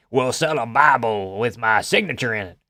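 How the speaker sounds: a mock Texan old-man sales pitch; Texan Trump impression. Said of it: "why he became Texan".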